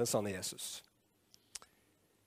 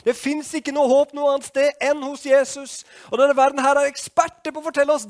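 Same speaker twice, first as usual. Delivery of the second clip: loud, high-pitched, urgent pulpit delivery; full-force preaching; 13 dB louder than usual. Acted. Preacher mocking the world's experts on false hope, full-force preaching. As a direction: build to near-shouting, pelting out the phrases.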